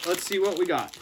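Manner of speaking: ordinary talk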